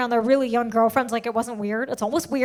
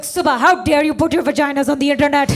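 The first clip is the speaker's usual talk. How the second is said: mock angry mom; 6 dB louder than usual. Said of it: "She's like".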